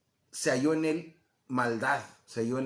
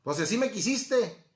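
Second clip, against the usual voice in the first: acted comeback, higher and punchy; defiant husband retort; 5 semitones higher, 3 dB louder than usual. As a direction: throw it back at a complaining wife. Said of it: "y le va a contestar".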